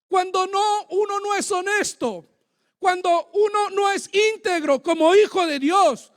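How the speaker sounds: loud, pressing warning from the pulpit; forceful exhortation. Real, not acted. Preacher warning those who are not honest, loud and forceful.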